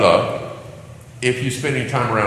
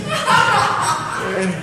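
imitated whimper.

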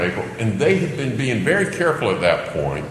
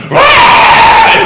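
very high, loud mock tantrum scream; imitating a toddler's shriek; 14 dB louder than usual. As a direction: shriek like a furious little queen. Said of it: "the queen let forth cries of terror".

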